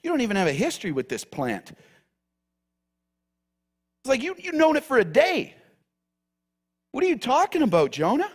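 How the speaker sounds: high, fast disbelieving question; incredulous God. Acted. God asking Jonah in high amused disbelief. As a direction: scoff like it's absurd. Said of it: "He goes".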